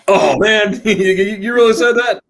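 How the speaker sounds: high, loud, playful disbelief; mock surprise. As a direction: tease them with big fake shock. Mock surprise at German friends.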